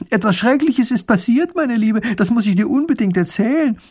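high, loud call across to the neighbour. A narrator's reading. Mathilde calls out of the window to her neighbour. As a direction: shout it out of the window, bursting with news. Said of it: "reißt das Fenster auf und ruft".

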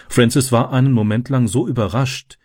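plain reading voice

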